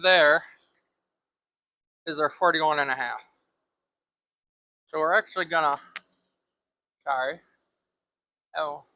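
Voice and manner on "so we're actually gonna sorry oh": talking around a marker held in teeth; words muffled by a pen between teeth; marker in mouth. Real, not acted. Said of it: "a marker in my mouth".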